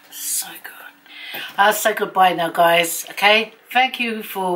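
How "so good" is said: very soft whispered aside. Real, not acted. Vlogger remembering the island's medicine man, whispering. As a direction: whisper it to yourself.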